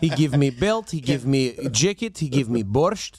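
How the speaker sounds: put-on thick comic Russian accent. Sambo guy vouching for himself to the checker, mock Russian accent. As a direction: list each gift in a hammy Russian accent. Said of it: "a really shitty Russian accent".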